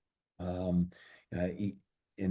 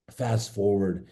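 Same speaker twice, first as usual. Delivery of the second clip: normal talking voice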